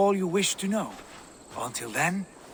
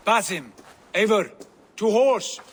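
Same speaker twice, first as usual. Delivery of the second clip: louder, higher call to ride; rallying call; 4 semitones higher, 4 dB louder than usual. Acted. Sigurd calling Basim and Eivor to horse, loud. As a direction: rouse them to mount up now.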